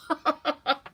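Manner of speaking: squirrel's teasing laugh; short mocking cackle. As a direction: laugh at the tiny mouse. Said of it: "laughed Squirrel".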